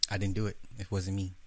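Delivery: low, flat, unbudging denial; quiet stubborn denial. Retold or imitated. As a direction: softly deny it to the grave. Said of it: "Deny to the grave".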